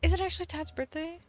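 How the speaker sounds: high-pitched gasping question; gasp, then ask in high disbelief; surprised. Real, not acted. Streamer learning it's Tad's birthday today, surprised.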